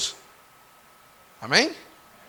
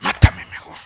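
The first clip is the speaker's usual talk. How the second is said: whispered plea. Acted. Moses asks to die.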